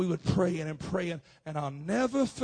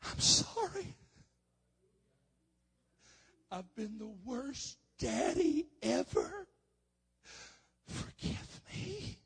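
very high, breaking into voiceless sobbed words; slow, tearful plea, squeezed high; crying apology, reenacted; 11 semitones higher, slower than usual. Father waking his young son to apologize, crying. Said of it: "I was crying".

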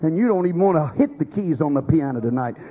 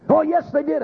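high, insistent caller's comeback; insistent contradiction; 9 semitones higher than usual. Retold or imitated. Preacher imitating a caller who insists it was said, high insistent contradiction.

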